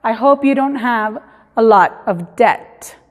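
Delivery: "debt" said det in careful English; teacher demo; English model sentence. Shown as a demonstration. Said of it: "espero que no tengan muchas deudas".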